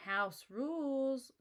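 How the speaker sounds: chorused reply. A narrator's reading. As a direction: chant it together like a crowd of critters. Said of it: "chorused the jostled lemmings".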